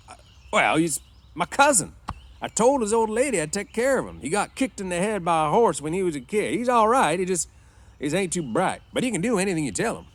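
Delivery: high, loud, hurried excuse; defensive George. A narrator's reading. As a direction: explain insistently to a suspicious boss. George covers for Lennie.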